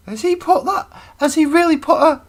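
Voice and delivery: high, loud falsetto of shocked oldsters; scandalized older people. Acted. Older people reacting to the term, high mock-shocked voice. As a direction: squawk it in a laughing falsetto. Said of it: "So the older people will be going".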